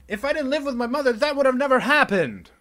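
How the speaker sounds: high, loud, exasperated insistence; heated protest. Real, not acted. Speaker blames living with mother for the slap, heated protest. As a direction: blurt it out, rising into a shout.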